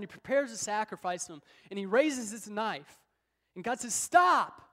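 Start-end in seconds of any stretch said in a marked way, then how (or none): 3.9-4.6 sudden booming cry of stop, far above the preacher's own voice; God stops Abraham from killing his son, with a booming shout; God's commanding shout. Acted. God halts Abraham's knife.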